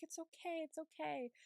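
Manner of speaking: soft, high-pitched mock reassurance; soothing nurse. Nurse to Nomi begging her to stop, soothing. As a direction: hush a patient in a small high voice.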